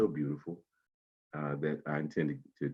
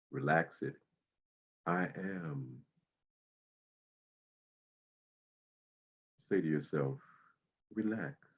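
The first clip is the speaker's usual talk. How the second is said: guided meditation. Acted. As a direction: ease them into calm breathing. Guides body-scan meditation.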